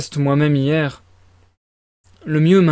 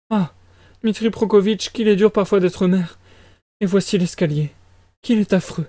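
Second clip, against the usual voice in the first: high-pitched flustered mother; worried mama in a raised, agitated voice; fretful mother character; 5 semitones higher than usual. Acted.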